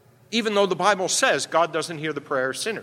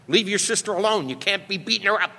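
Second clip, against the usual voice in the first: scolding parent; 4 semitones higher, faster than usual. Retold or imitated.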